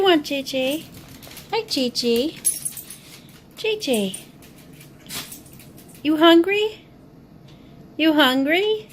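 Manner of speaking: high, bright dog-talk voice. Real, not acted. Talking to dog J J.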